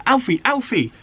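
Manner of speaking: high, bright calling cub voice; excited call. A narrator's reading. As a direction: call out to her brother, thrilled. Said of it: "she called".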